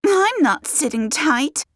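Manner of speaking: high, slow indignant refusal; defiant refusal. A narrator's reading. Guest storms off in a quarrel.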